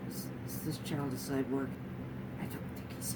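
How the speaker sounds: hushed whisper; mock-whisper it like a scared onlooker; half-joking whispered aside. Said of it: "they're like".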